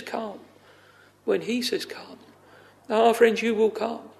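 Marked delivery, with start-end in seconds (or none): 0.0-2.2 hushed call. Acted. Preacher voicing Jesus calling the soul, hushed. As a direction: let the call drop almost to nothing.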